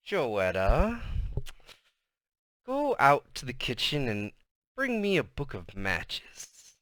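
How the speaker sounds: strange, sinister mother's voice; hissing snake-like. A narrator's reading. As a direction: draw it out softly like a snake. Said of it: "Mama's voice got strange, hissing like a snake".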